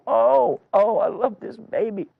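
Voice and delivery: high, loud mock-tearful gushing; weepy-happy wail, put on; happy crying. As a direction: act overcome with joy, high-pitched. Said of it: "crying, and".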